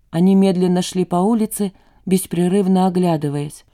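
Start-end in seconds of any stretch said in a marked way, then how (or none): none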